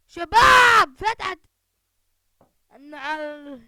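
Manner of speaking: high, very loud shout; excited shout. Real, not acted. Calling his teammates about the opened locker, shouting. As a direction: yell it out to the whole squad. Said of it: "شباب، فتحت الخزانة".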